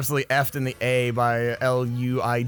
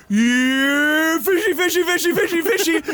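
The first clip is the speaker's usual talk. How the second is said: drawn-out first word, then high rapid hollering; loud fish call; 12 semitones higher, 5 dB louder, slower than usual. Retold or imitated. Ernie showing Bert how you fish, loud call. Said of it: "like, loud and quiet".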